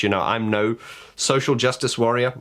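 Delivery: usual speaking voice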